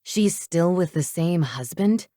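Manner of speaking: usual speaking voice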